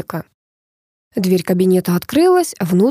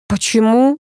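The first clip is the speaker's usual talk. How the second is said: mildly indignant question; 3 semitones higher, slower than usual. A narrator's reading. Star objects to cutting her boot advert, indignant. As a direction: protest with a touch of outrage. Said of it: "возмутилась звезда".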